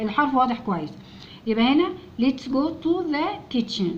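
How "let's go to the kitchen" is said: let's go to the kitchen, slowly; slow reading. Shown as a demonstration. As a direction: read it out slowly for learners. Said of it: "teacher reads sentence slowly". (قراءة بطيئة)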